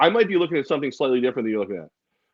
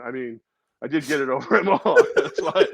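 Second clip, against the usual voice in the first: ordinary talk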